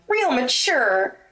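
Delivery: mock-dry scorn; sarcastic. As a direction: drip scorn on it slowly. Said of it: "snapped the blonde".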